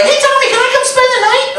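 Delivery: put-on high, little-kid voice; a six-year-old nephew calling his uncle Tommy, high and eager. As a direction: squeak it like a small boy asking a favour. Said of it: "he's like".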